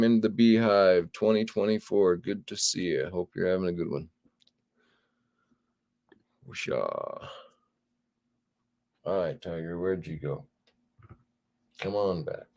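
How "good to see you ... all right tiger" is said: normal talking voice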